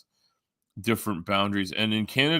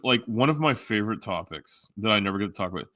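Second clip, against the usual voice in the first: ordinary delivery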